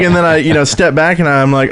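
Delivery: ordinary delivery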